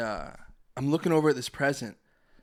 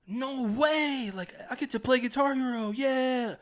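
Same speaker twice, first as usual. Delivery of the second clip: much higher, performed delight; acted surprise; 11 semitones higher than usual. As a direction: fake the thrilled kid. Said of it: "let me do my thing".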